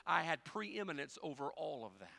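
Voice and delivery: normal talking voice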